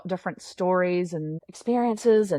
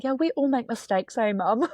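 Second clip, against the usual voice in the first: put-on higher, sweet little-girl voice; little daughter; 6 semitones higher than usual. Daughter rubbing mum's shoulder, gentle higher child voice. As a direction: lightly voice the four-year-old comforting mum. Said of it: "be like".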